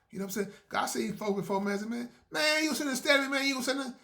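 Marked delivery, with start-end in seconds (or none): 1.9-3.9 high loud indignant call-out; mock angry confrontation. Old acquaintance confronting him over staring, loud and indignant.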